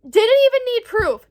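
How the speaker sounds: disbelieving exclamation; incredulous outburst. Real, not acted. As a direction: fling it out in disbelief. Incredulous rant.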